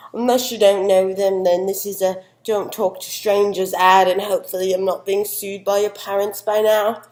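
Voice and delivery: a hint of a chatty Southern host, often loud; lightly voiced Southern guest. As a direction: play the bubbly Tennessee expert chatting with her dog. Janine character segment.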